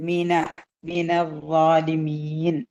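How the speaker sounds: slow, level model recitation; measured, drawn-out phrase for pupils to follow; Quran recitation. Shown as a demonstration. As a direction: recite with long held vowels. Recites the verse being taught.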